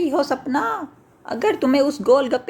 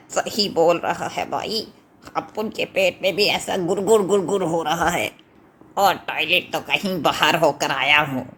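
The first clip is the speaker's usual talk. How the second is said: a lower-voiced brother in pain; second brother's voice; 8 semitones lower than usual. Brother agreeing with Chintu, lower and pained. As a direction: lower voice, complaining of stomach ache.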